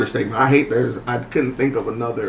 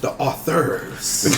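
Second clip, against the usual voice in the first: slow, stretched-out announcement; drawn-out hype; 5 dB quieter, much slower than usual. Real, not acted. Host hyping a guest author, drawn out. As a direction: draw the words right out to hype a guest.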